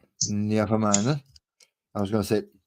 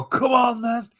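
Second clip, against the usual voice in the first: loud, high-pitched taunt; goading call-out; 14 semitones higher, 5 dB louder, slower than usual. Acted. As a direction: call them out for a fight, loudly. Acts out goading at a match.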